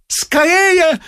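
high, loud urgent shout. A narrator's reading. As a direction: yell to hurry them. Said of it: "закричал Ипполит Матвеевич".

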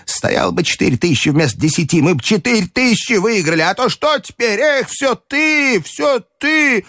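put-on high, mounting old-lady complaint; shrieky, aggrieved reproach; reproachful grandmother. A narrator's reading.

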